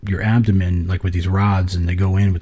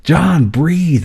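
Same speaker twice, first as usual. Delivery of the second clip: clear, high-pitched urgent order, up close; sharp, distinct retold command; right-in-the-face command; 7 semitones higher than usual. Shown as a demonstration. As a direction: imitate a doctor calling straight into a face. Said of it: "it's right there on my face".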